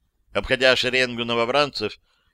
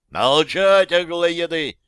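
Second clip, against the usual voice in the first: loud threatening bark; menacing shout; 6 semitones higher, 5 dB louder, slower than usual. A narrator's reading. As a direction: silence them with a bark. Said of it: "крикнул он грозно".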